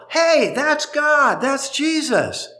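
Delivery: put-on high, slow, wide-eyed child's voice; a small child pointing and marvelling; excited child. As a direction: call out like an amazed little kid. Children crying out in temple.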